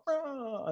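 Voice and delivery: quick high yelp of a struck Jedi; mock death cry. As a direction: toss off a tiny high ah as if cut down. Jedi cut down in comic.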